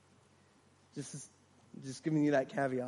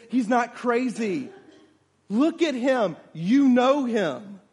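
slow, raised, pressing each phrase; emphatic insistence; 7 semitones higher, 9 dB louder, slower than usual. Acted. Woman correcting a man who calls him crazy, emphatic insistence. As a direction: insist firmly, stretching the words.

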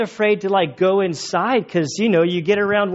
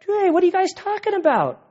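high, breezy "hey guys" voice; casual Jesus; 10 semitones higher than usual. Retold or imitated. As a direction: stroll up and chat like a curious stranger. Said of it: "he's just like".

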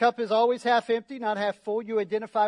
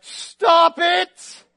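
wife snapping; 7 semitones higher, 11 dB louder than usual. Retold or imitated. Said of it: "And my wife is like".

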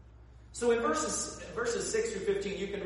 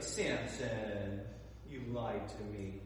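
fake pouty hurt; 7 semitones lower, 6 dB quieter, slower than usual. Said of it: "Fake tear, pouty face".

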